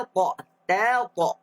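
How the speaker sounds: normal talking voice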